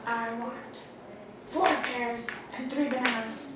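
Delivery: quiet lizard voice. Acted. Lizard orders fruit.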